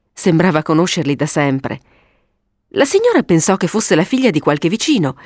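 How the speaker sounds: plain reading voice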